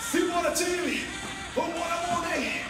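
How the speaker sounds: high energetic coach shout; hype shout. Real, not acted. Coach hyping class.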